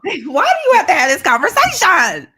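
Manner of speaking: high, loud, laughing protest; amused exasperation at full voice; exasperated outburst. Real, not acted. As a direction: cry it out through a laugh. Laughing outburst at friend's story.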